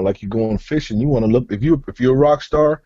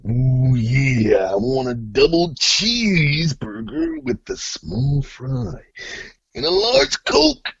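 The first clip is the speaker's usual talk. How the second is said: gravelly wrestler growl, drawn out; Macho Man impression; 3 dB quieter, slower than usual. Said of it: "the few guys I know actually pull that fucking voice off".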